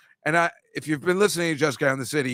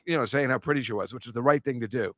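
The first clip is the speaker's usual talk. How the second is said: ordinary talk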